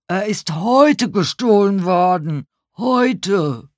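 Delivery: high, loud protest; upset old man. A narrator's reading. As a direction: insist loudly to someone who has missed the point. Robbed old man insists, upset.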